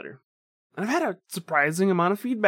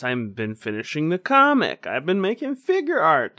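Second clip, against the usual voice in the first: usual speaking voice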